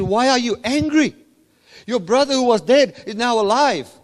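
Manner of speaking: the father cries out to his angry son, pleading and loud. Acted. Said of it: "He says".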